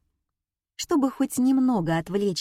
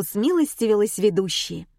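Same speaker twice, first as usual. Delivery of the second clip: plain reading voice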